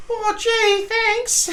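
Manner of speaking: very high falsetto gee-whiz voice; put-on falsetto thanks; squeaky grateful underling. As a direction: gush meekly in a cartoon squeak. Voices meek hero thanking colonel.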